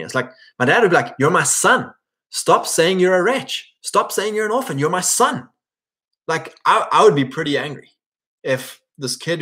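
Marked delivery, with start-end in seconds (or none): 1.2-5.5 high-pitched, insistent dad; acted fatherly protest, higher and drawn out. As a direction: plead with your son, pitched well up. Imagined dad protests son's self-label.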